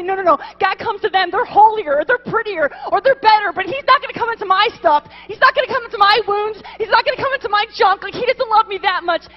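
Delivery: high, loud, insistent build of objections; impassioned self-doubt. Acted. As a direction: preach the inner doubts as if pleading. Preacher voices listeners' anguished self-doubt.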